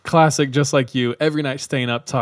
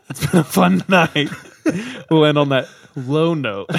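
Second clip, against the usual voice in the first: laughing; 4 semitones higher, 5 dB louder than usual. Real, not acted. Wrapping up while laughing.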